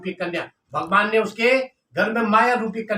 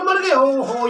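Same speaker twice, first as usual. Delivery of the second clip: shocked dismay; 3 semitones higher, slower than usual. Acted. Pandit hearing his host has died, shocked dismay. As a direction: react aghast at sudden news.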